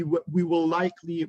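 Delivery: ordinary delivery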